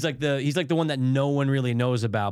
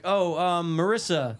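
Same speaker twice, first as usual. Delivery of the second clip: usual speaking voice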